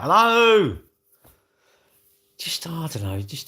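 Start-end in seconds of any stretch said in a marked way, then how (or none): 0.0-0.8 high, loud mock hello yelled out a window; window shout. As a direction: call it out the window to strangers. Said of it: "hang out the window and shout out".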